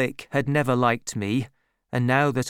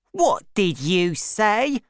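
much higher, slow outraged cry; shouting; 8 semitones higher, 3 dB louder, slower than usual. A narrator's reading. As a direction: demand it in outrage. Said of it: "my sister shouted at Orlick".

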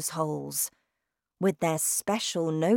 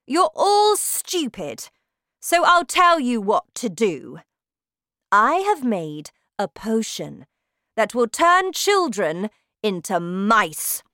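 shouting witch; 5 semitones higher, 8 dB louder than usual. Acted. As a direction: bellow like a terrifying witch queen. Witch explains her plan.

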